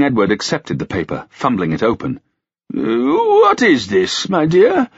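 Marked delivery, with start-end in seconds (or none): 2.7-4.9 put-on very high, slow old king's question; puzzled old king. A narrator's reading. Old king fumbling open the paper, puzzled. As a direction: ask it with a dim, doting puzzlement.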